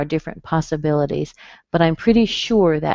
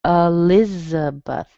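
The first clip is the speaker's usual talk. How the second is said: Elizabeth drawn out, strong on LIZ; slow stress demo; slower than usual. Shown as a demonstration. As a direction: say e-LIZ-a-beth slowly as one word, Elizabeth. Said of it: "here's a good example".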